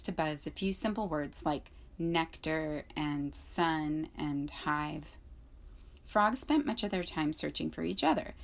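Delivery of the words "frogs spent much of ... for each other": plain reading voice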